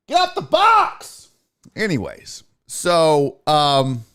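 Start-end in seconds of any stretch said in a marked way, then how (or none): 0.1-1.3 high, drawn-out yell at the cat; scolding call. Real, not acted. Host breaking off to cat in the box, high scolding yell.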